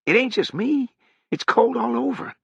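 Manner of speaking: high, whiny complaint. Acted. Harrogate pleads with Sutri, high voice. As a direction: pitch it up and plead.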